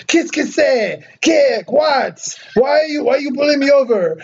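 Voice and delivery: high, fast, flustered French Canadian voice; Quebecois French guy. Retold or imitated. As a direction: mimic an upset Quebecois man, pitched high. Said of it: "the first thing that I hear from the guy".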